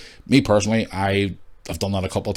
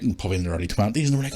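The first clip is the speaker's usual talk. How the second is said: usual speaking voice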